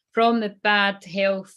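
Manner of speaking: usual speaking voice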